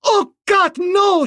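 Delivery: high, loud horrified cry. A narrator's reading. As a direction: cry out in horror, pitched high. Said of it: "she cried".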